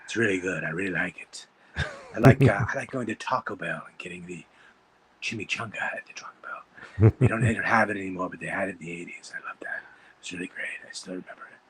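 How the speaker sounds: low, murmured Baldwin voice drifting into a whisper; Alec Baldwin impression. Comic pal doing Alec Baldwin over chimichangas, low murmur. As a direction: sink low and breathy like a smoky Baldwin. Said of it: "Alec Baldwin".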